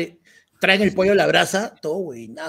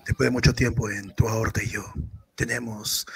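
romantic voice; 8 semitones lower, 7 dB quieter than usual. Pretend. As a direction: read it like a love dedication. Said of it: "voy a poner la voz romántica".

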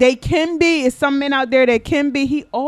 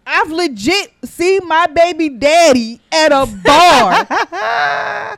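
loud, slow, shouted setup to the story; excited near-shout; 5 semitones higher, 7 dB louder, much slower than usual. Real, not acted. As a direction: announce it like big news, loud and drawn out. Excited retelling, measured shout.

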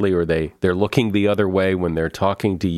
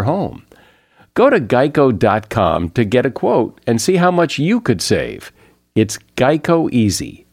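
louder, higher, punchy sponsor read; 4 semitones higher, 5 dB louder than usual. Acted. Host pitching insurance bundling to listeners, upbeat ad read. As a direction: sell it as a polished podcast advert.

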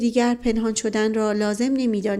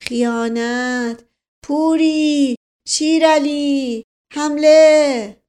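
drawn-out battle roar, building louder and higher; 5 semitones higher, 6 dB louder, much slower than usual. A narrator's reading. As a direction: bellow each word like a command to charge. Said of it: "صدای نعرهٔ دایجان در اتاق پیچید".

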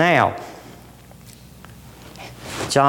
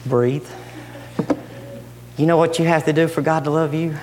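ordinary talk, nothing marked

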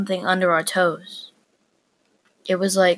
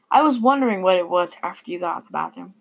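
ordinary delivery

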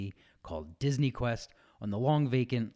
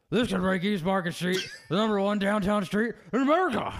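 higher, louder, hammed-up politician voice; Ed Rendell voice; 9 semitones higher, 4 dB louder than usual. Shown as a demonstration. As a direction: boom it out like a proud mayor. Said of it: "Can you read it in an Ed Rendell voice?".

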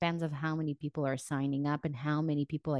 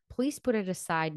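normal talking voice

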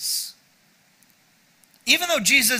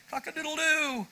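high, soft rooster crow; 7 semitones higher, 8 dB quieter than usual. Retold or imitated.

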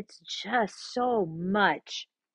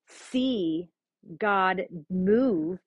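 ordinary talk, nothing marked

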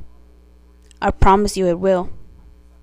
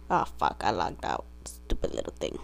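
unvoiced, annoyed whisper to herself; whispered grumble; 11 dB quieter than usual. Real, not acted. Annoyed after logging out by mistake, whispered. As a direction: hiss it under your breath at the phone.

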